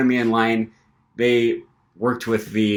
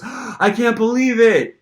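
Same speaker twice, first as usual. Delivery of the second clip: high, slow amazed voice; mock thrilled employee; imitated excited disbelief; 11 semitones higher, 4 dB louder, slower than usual. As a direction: quote him gushing, pitched way up. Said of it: "I can tell he's really excited".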